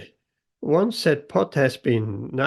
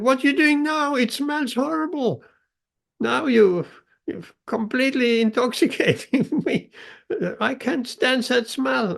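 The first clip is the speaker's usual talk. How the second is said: much higher, squeaky disgusted vase; disgusted shouting vase; 9 semitones higher than usual. Acted. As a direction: squeal in mock disgust at the stink. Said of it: "that vase was shouting".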